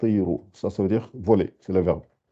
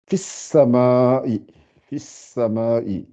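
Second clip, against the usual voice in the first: slow drilled phrase; much slower than usual. Shown as a demonstration. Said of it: "faut bien, bien articuler".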